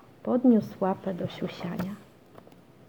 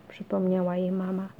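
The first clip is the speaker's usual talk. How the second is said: ordinary delivery, nothing marked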